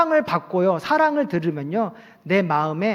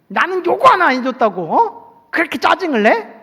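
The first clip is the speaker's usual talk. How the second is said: higher, louder irritated scolding; angry outburst; 5 semitones higher, 3 dB louder than usual. Acted. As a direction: snap back defensively, blaming them. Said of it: "오히려 버럭 화를 내요". (버럭 화내며 높고 큰 목소리로 따지듯)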